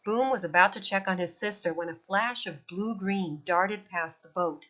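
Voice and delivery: usual speaking voice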